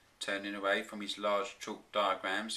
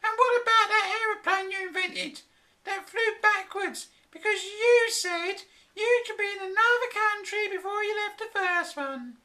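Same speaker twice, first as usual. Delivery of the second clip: high, louder mocking tone; mock his invention, high and sneering; mocking wife; 6 dB louder than usual. A narrator's reading. Flora ridiculing the backwards aeroplane, high mocking voice. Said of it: "she said mockingly".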